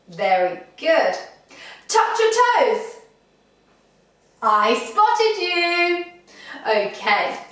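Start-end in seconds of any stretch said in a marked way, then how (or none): 1.9-3.0 high, quick catch-them-out order; playful trick command. Pretend. 4.4-6.0 high, drawn-out teasing call; sing-song teasing as you catch them out; playful gotcha. Pretend. Catching players out in game.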